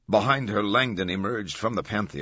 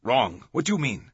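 louder, puzzled questions rising in pitch; bewildered; 6 semitones higher, 5 dB louder than usual. A narrator's reading. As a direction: ask it as if caught off guard. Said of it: "Olivetti sounded bewildered".

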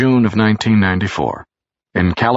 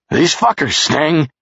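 higher, slow yell at the door; 10 semitones higher, 3 dB louder, slower than usual. A narrator's reading. Charles yells at Jerry's bathroom door, higher and slow. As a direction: call the complaint out at the door. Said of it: "Charles yelled right at the door".

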